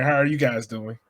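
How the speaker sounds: usual speaking voice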